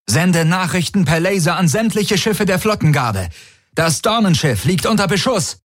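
high, urgent command all through; urgent call. A narrator's reading. Tari ordering a message to the fleet, urgent call. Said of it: "rief Tari".

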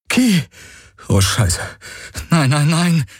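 high, distraught cries; panicked. A narrator's reading. Galen to collapsed Ki, panicked. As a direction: cry out in horror, pitch leaping.

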